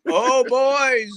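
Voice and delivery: high Minnesota singsong; Minnesota-nice exclamation; mock Minnesota accent. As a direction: imitate a polite Minnesotan, drawn high. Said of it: "that accent".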